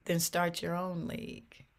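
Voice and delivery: normal talking voice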